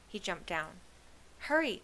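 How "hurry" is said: high, loud urging; urgent cry. A narrator's reading. Kenny rushing his friends, urgent cry. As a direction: cry out to rush the group. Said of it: "cried Kenny".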